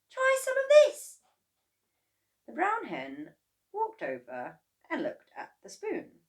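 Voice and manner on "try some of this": very high, louder coaxing voice; coaxing boy. A narrator's reading. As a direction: tempt the hen with the spoon. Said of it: "he said".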